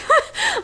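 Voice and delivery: quick high giggle at herself; embarrassed little laugh; short laugh. Real, not acted. Laughs at own accent.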